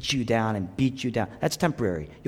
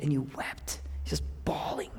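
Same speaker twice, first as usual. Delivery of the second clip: hushed; 11 dB quieter than usual. Real, not acted. Hushed about weeping.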